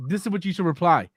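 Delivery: normal talking voice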